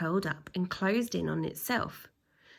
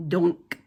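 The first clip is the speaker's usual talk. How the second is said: loud; 3 semitones lower, 7 dB louder than usual. Acted. Bounce sound.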